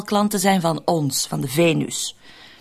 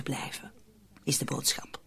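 whispered; 5 semitones lower, 14 dB quieter than usual. Acted. Conspirator whispering the rule to her accomplices. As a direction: drop to a breathy unvoiced whisper. Conspirators keeping their voices down.

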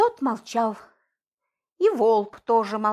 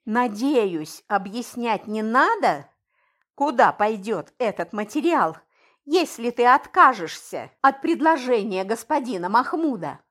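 ordinary delivery

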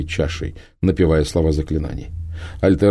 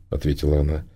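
plain reading voice

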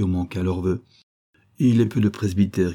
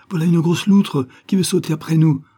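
frightened cry; 8 semitones higher, 6 dB louder than usual. Acted. Jeanne cries out in fright.

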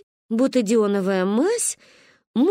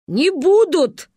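громко, медленно, по слогам; отрезать; прогрохотала; 8 semitones higher, 6 dB louder, much slower than usual. Acted. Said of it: "прогрохотала Ба". (грохочущий отказ)

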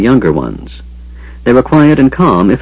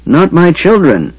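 high, slow, protective anger; mother's rage, not shouted; restrained rage; 8 semitones higher, slower than usual. A narrator's reading. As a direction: draw it out in anguished anger. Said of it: "she said with rage".

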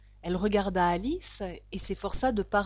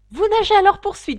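quick, high yell; excited shout; 10 semitones higher, 14 dB louder, faster than usual. A narrator's reading. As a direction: shout it fast and very loud. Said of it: "cria le griffon". (crier vite et très fort)

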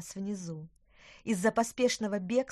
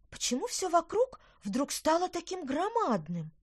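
put-on high, bewildered little girl; 10 semitones higher, 3 dB louder than usual. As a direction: ask in confused wonder. Girl bewildered at giant world.

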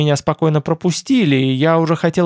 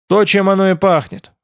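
gloomy; 5 semitones higher, 3 dB louder than usual. A narrator's reading. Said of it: "хмуро ответил Тмор".

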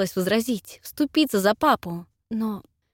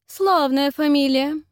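slow, drawn-out appraising lady's voice; prim older lady; 7 semitones higher, 5 dB louder, slower than usual. A narrator's reading. Miss Mercer appraising the fiancé's surname, slow and prim. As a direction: weigh each word like a judging aunt.

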